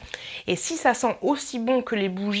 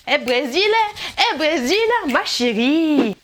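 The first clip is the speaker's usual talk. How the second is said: mock call out; 5 semitones higher, 6 dB louder, slower than usual. Calling out to Brazil playfully.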